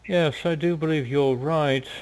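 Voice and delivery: a hint of a pompous boar leader; chubby boar leader. Boar leader agrees with the elf, lightly voiced.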